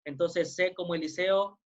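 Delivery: normal talking voice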